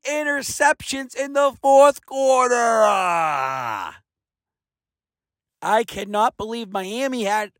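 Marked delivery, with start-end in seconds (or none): 2.5-4.0 low, pained shout; anguished groan. Real, not acted. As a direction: groan in frustration. Despair at the interceptions.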